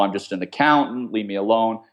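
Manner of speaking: usual speaking voice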